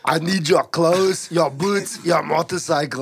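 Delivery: full-voiced, slow Austrian impression; emphatic cyborg demand, Austrian accent; Arnold. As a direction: do the Terminator with a thick Austrian accent. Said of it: "jerking off to too much Arnold".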